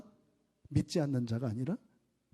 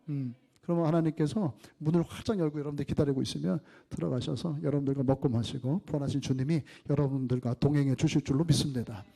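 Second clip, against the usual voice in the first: ordinary talk, nothing marked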